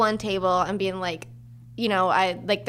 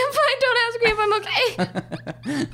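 very high, wobbling mock I'm fine; mock about to cry; 13 semitones higher, slower than usual. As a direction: pretend to hold back tears, cracking up. Server about to break down.

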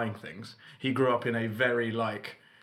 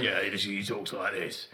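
slightly lowered, put-on Cockney dad; Cockney dad impression; deep Cockney voice; 3 semitones lower than usual. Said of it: "With his deep and Cockney voice".

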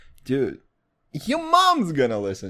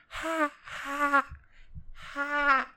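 high, soft drawn-out "ha" moans; mating turtle cry; 10 semitones higher, 7 dB quieter, much slower than usual. Retold or imitated. Mocking his own high voice, turtle mating imitation. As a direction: squeak like an amorous turtle. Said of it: "like a turtle that's fucking".

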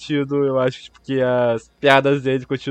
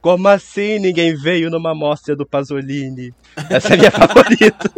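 imitando fala de filme; 4 semitones higher, 6 dB louder than usual. Retold or imitated. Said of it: "citando frase do filme".

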